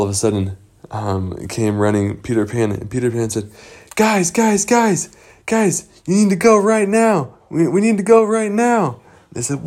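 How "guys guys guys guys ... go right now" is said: high-pitched, insistent rallying call; urgent alarm. Acted. Peter Pan running in to the Lost Boys, urgent. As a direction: round up the gang urgently.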